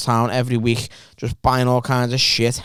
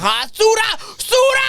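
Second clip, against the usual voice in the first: retold shout; slower than usual. Pizza lads calling out to ginger teen, shouting. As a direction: yell the nickname across the chippy. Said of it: "shout something at me".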